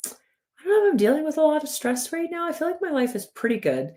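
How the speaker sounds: high-pitched mock unaware woman; clueless woman client. Woman insisting to a coach she isn't stressed, high mock-innocent voice. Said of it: "I'll talk to women and they'll be like".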